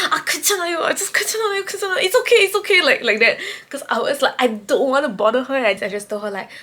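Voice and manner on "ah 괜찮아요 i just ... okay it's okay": high-pitched, repeated backing off; hasty, squeaky it's-fine; flustered reassurance. Retold or imitated. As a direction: wave it away in a high voice. Retold flustered backing off from request.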